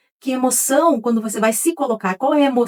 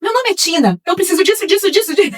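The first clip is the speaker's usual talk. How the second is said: self-mocking rush; 8 semitones higher than usual. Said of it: "eu falo assim".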